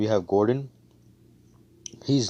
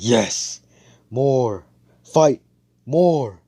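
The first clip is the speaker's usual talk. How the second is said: loud, higher bursts of bloodlust; battle-crazed shout; 4 semitones higher, 8 dB louder, much slower than usual. Retold or imitated. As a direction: punch out each word like a war cry. Retold battle-crazed warrior cheer.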